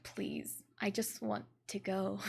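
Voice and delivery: soft, breathless whisper, begging to leave; whispered plea. Retold or imitated. Wife waiting in the car for her husband, whispered plea. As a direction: whisper it like you're stuck waiting.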